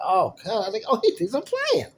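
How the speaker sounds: exasperated "oh God"; exasperated disbelief. Retold or imitated.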